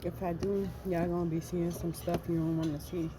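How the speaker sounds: ordinary talk, nothing marked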